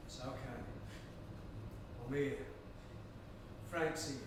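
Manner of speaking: soft, low comforting murmur; soothing. Acted. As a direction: hush him gently as you rock him. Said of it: "slowly cradles him while he weeps".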